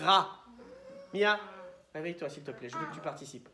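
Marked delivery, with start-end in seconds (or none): 0.0-0.4 "Ra" loud, growled R opening into A; syllable sounded out. Shown as a demonstration. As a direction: growl the R then land loudly on "Ra". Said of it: "Qu'est-ce qu'on entend juste après". (« Ra » fort, R grondé puis A)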